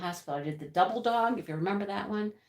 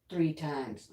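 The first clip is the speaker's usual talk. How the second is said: usual speaking voice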